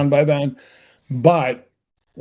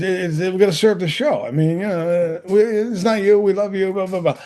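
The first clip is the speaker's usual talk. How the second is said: high, stammering run of excuses; imitated flustered boss; 5 semitones higher than usual. Boss letting actor go, mock flustered babble. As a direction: fumble the excuse, higher and hurried, tailing into filler.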